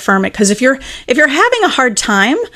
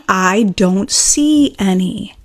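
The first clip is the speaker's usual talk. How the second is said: very slow, deliberate statement; slow declaration; 2 semitones lower, much slower than usual. Acted. Teacher showing what the doubt declares, slow declaration. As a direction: spell it out slowly, word by word. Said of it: "Now you're saying".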